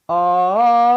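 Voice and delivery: cracking flip up into falsetto; voice break into falsetto. Shown as a demonstration. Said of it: "a flip into falsetto like this".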